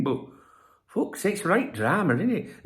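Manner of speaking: ordinary delivery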